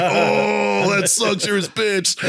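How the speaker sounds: high, hooting taunt; mock gleeful jeer. Lieutenant jeers at the man who lost at spades, hooting taunt. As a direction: rub it in, imitating a heckler. Lieutenant mocks the losing player.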